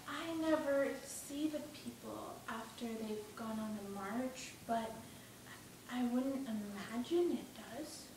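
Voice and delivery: put-on soft, hesitant boy, halting; subdued Shmuel. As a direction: answer quietly and haltingly. Voices Shmuel answering.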